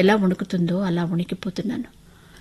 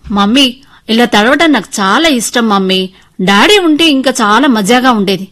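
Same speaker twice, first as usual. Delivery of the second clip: put-on excited child, louder and pitched up; delighted little girl; 3 semitones higher, 10 dB louder than usual. Girl telling mother she loves getting wet, excitedly. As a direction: play a thrilled child telling mum.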